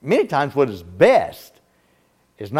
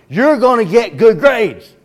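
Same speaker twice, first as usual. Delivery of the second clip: mock parent, much higher and louder, slow and hammering; insistent parent; 8 semitones higher, 6 dB louder than usual. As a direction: drill it in like a parent losing patience. Parent insists, stronger repeat.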